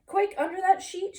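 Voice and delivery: high loud urgent shout; urgent high call to hide. A narrator's reading. The four bunnies shouting to Wilbur to hide. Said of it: "shouted the four bunnies".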